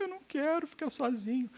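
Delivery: put-on high whiny boy pleading; crying child voice. Boy whines to mother he doesn't want to be alone. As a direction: sob it out like a little boy. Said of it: "Aí abre a boca".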